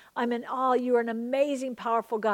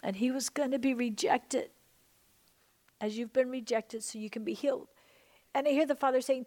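ordinary delivery

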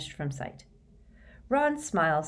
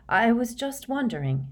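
firmer, higher-pitched restatement; stronger voice; 7 semitones higher than usual. A narrator's reading. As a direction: gather resolve and speak up a little. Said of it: "her voice stronger now".

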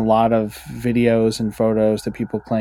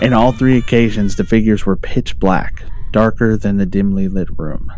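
louder, spooky storyteller read; ghost story voice; 5 dB louder than usual. Acted. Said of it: "his ghost story voice".

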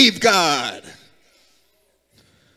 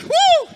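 high ecstatic woo. Real, not acted. Preacher's high ecstatic cry.

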